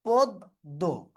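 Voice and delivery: পদ্ম drawn out as "pod... do", rounded ও; slow demonstrated পদ্ম. Shown as a demonstration. Teacher answering how পদ্ম is pronounced, slow syllable-by-syllable demo. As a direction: split পদ্ম slowly into two syllables, first vowel ও. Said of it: "তাহলে এটার উচ্চারণ কি হবে".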